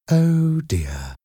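high start dropping away in disappointment; disappointed. A narrator's reading. Caller learning there is a boy, disappointed. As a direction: let the hope fall out of your voice. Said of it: "the caller sounded disappointed".

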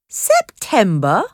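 drawn-out, rising question of disbelief; incredulous exclaimed question; surprised exclamation. A narrator's reading. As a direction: echo it back slowly, astonished. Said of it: "Harvey exclaimed".

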